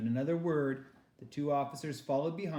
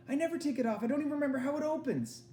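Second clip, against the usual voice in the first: put-on frightened girl; 13 semitones higher, faster than usual.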